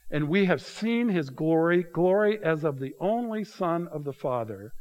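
measured, emphatic Bible reading; preacher reading from the pulpit, deliberate; scripture reading. Acted. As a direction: read scripture slowly, each phrase weighed. Reads John aloud in sermon.

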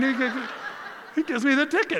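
high, loud, laughing through the words. Real, not acted. Preacher laughing as he tells the congregation about the ticket. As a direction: giggle out the punchline. Laughing while telling the story.

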